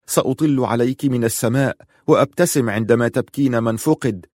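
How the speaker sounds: ordinary delivery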